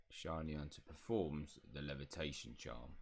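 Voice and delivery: low, soft house elf instructor; Pitts. Acted. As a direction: give the order quietly in a low elf voice. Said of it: "elf voice".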